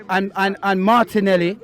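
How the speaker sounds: ordinary talk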